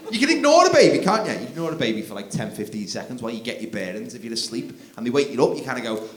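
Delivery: normal talking voice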